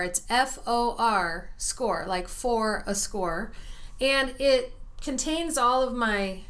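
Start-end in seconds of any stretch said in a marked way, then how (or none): 0.0-3.5 "F O R Score" spelled letter by letter, slow; spelled slowly. Shown as a demonstration. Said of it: "is called Four Score".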